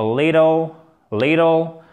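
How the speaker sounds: "little" with the T turned almost into a D; American flapped T. Shown as a demonstration. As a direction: say "little" the American way, close to "liddle". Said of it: "ça se prononce presque comme un de". (« little » à l'américaine, le T presque comme un D)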